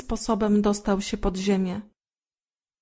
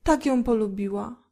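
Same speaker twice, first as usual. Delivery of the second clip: plain reading voice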